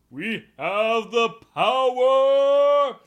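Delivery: huge high shout, last word held long; heroic battle cry. Acted. Hero pulling the kids down, triumphant mock battle cry. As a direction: bellow a drawn-out superhero catchphrase.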